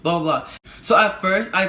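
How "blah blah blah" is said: imitating a man calling out, mildly raised voice; mock call to someone walking off; retold yell. Man yelling at her as she walks home, mild mock yell. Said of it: "this man yelling at me".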